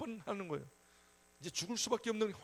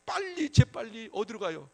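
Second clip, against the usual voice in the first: ordinary talk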